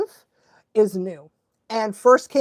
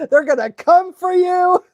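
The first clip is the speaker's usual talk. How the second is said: higher, talking through laughter; laughing; 8 semitones higher, 4 dB louder than usual. Real, not acted. Recalling her dread of social media, laughing. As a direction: laugh through the mock warning.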